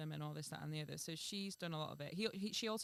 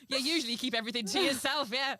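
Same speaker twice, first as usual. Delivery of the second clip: high, loud laughing sarcasm; mock her through laughter; sarcastic; 6 semitones higher, 11 dB louder than usual. Real, not acted. Sarcastic jab while laughing.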